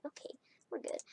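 hushed whisper to herself. Real, not acted. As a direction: whisper it under your breath. Reassuring herself, then shouting.

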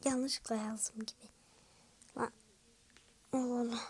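hushed whispered aside; soft secretive whisper. Real, not acted. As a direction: whisper it so nobody hears. Sneaky aside while typing.

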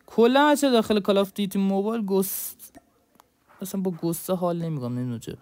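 ordinary delivery

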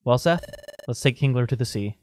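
low, subdued character voice; resigned game character. Acted. Voices game characters deciding to part.

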